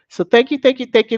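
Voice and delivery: excited thank you; gushing thanks. Real, not acted. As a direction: pour out the thanks.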